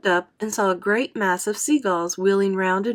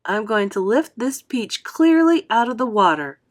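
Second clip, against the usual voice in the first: firm; 4 semitones higher, 6 dB louder than usual. A narrator's reading. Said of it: "James announced firmly".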